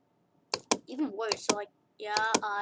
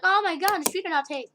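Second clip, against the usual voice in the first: mock whiny complaint, raised pitch; mock complainer; 7 semitones higher, 7 dB louder than usual. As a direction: play a fussy complainer.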